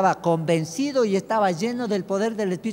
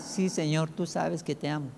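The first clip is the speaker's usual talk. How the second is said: quiet reply; 3 semitones lower, 8 dB quieter than usual. Retold or imitated. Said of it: "Le vuelve a preguntar".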